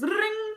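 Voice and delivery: high, drawn-out ringing noise; trilled "ring" held high; school bell ring. Retold or imitated. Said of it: "bell rings".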